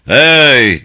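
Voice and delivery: loud, drawn-out call; bağırış. A narrator's reading. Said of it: "diye bağıran Mike". (bağırarak seslenme)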